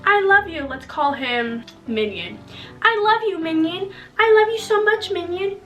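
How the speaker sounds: gushing mock recital of cards; hammed-up lovey-dovey reading; mock reading love notes. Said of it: "she saw all of the".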